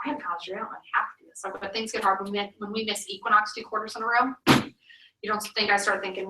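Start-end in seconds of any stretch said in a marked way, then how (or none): 0.1-1.4 much softer, half-whispered doubt; inner-thought murmur. Retold or imitated. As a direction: voice the doubt you'd only think, barely aloud. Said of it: "those are the ones that go through my head".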